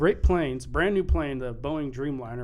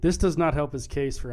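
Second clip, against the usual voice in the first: normal talking voice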